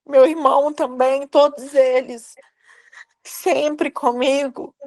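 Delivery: on the edge of crying, words catching; voice breaking. Real, not acted. Recalling postpartum grief to the host, voice breaking in real tears. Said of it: "Desculpa, Juana".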